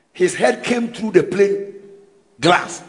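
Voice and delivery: ordinary delivery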